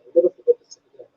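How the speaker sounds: ordinary delivery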